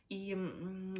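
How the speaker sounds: ordinary talk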